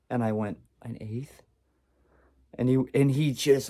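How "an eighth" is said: very soft, hesitant reply; sheepish answer. Retold or imitated. Answering his laughing brother, sheepish and quiet. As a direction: admit it in a small voice.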